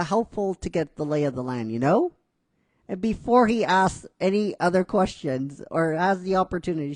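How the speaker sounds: ordinary talk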